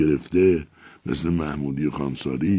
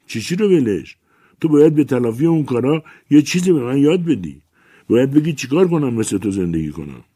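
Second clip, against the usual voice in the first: much higher, louder insistent outburst; indignant demand; 8 semitones higher, 5 dB louder than usual. Acted. Patron demands the poet teach him how to live, indignant and loud. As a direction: demand your due, voice up high.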